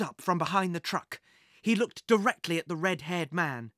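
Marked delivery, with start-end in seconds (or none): none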